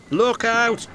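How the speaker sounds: high, loud cry of warning; shouted warning. Acted. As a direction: yell it to stop him. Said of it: "he shouted".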